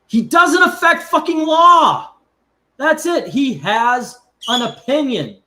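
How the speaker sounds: real angry outburst, high and loud; outraged shout. Real, not acted. Co-host fuming that a man was killed over an opinion, outraged shout. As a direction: shout it slowly with full outrage, pitch soaring.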